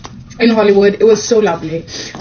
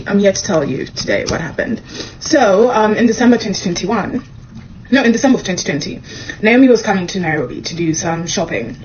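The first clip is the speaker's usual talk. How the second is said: ordinary talk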